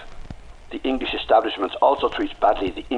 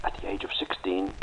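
secret whisper; 9 dB quieter than usual. Acted. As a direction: lean in and whisper it. Confessing a secret past in a cell.

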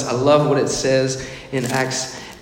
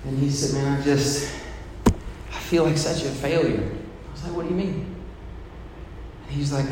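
quiet storytelling; 5 dB quieter than usual. Real, not acted. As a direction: bring it down close and personal.

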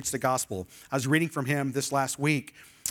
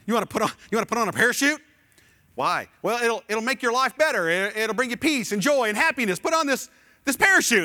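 higher, louder, rapid sales pitch; a fast, eager pitchman pushing a parachute; pushy salesman; 7 semitones higher, 6 dB louder, faster than usual. Retold or imitated. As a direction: oversell it like a hawker.

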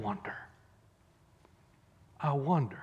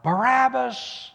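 higher, louder, slow cry; crowd crying out; 9 semitones higher, 6 dB louder, slower than usual. Retold or imitated. Preacher voicing the crowd crying for Barabbas. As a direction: cry the name out. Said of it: "they cried out".

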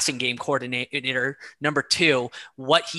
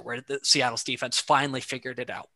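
normal talking voice

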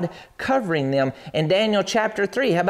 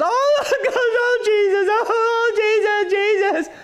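high wailing mock panic, laughing; hammed-up terrified wail of Jesus; panicking in jail, hammed up; much slower than usual. Mocking panicked believers.

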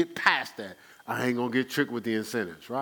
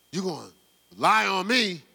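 rising, louder accusing question; angry accusation; 6 semitones higher, 5 dB louder than usual. Retold or imitated. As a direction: confront them, voice climbing. Acting out a confrontation.

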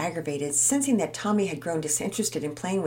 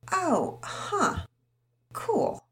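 half-hearted cool, not really meaning it; a hollow, unimpressed reply; unconvinced; 12 semitones higher, much slower than usual. Acted. Tommy hearing Todd is their best friend, unconvinced. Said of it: "didn't sound like he really meant that".

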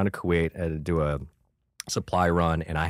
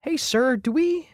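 much higher, overly polite voice; mock innocent. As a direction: play dumb in a high sweet voice. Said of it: "I'm like".

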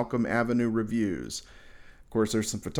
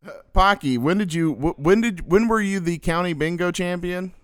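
excited; 7 semitones higher, 5 dB louder than usual. Acted. Excited interviewer hamming it up.

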